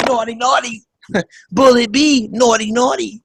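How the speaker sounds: high, sexualized mock foreign accent; Melania Trump. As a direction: coquettish imitation in an Eastern European accent. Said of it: "woman with a foreign accent".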